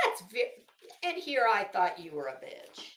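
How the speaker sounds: usual speaking voice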